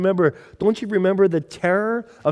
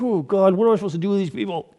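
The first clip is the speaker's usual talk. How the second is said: fast, mildly exasperated plea; a hint of a weary leader; exasperated Moses; 5 semitones higher, faster than usual. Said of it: "And Moses is like".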